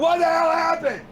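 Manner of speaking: high mock scream of panic; retold scream. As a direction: shout it up high, like you just lost the stream. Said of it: "I screamed".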